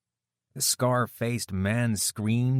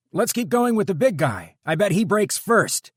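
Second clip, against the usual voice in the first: much higher, loud shout over screaming; 13 semitones higher, 8 dB louder than usual. A narrator's reading. Kenny shouting over the brute's screams, loud. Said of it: "Kenny shouted to be heard".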